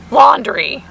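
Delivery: ordinary talk, nothing marked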